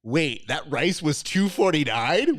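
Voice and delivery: mock disbelief at the price; incredulous outrage. Contestant baffled by the price of rice, incredulous.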